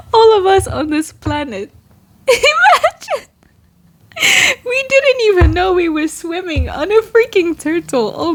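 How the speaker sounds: high, laughing through the words; can't stop giggling at the idea. Real, not acted. Cracking up at her own idea.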